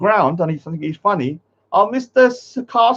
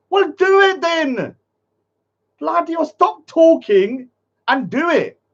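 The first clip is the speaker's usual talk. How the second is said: higher and slow, daring; taunting shout; 9 semitones higher, slower than usual. Real, not acted. Streamer mocking the threat of cousins coming, taunting shout.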